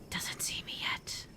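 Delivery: slow, barely-there whisper; tense whisper while hiding; hushed whisper. Real, not acted. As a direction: whisper so the zombie won't notice. Hiding from zombie in game.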